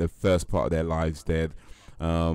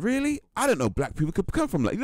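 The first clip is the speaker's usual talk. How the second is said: high, incredulous mock quote; mock American guy; 13 semitones higher than usual. Imitating the American guy in South Africa, high and incredulous. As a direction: pitch it up like a clueless American. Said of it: "And he's like".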